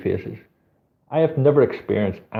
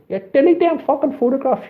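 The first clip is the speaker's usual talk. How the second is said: put-on high squeaky girl's demand; imitating girl ordering him to delete; mock furious girl; 3 dB louder than usual. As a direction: pitch it way up as the angry girl. Voicing the girl again.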